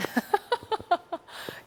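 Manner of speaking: short airy laugh before answering; a light unvoiced chuckle; breathy laugh. Real, not acted. Asked about her career climb.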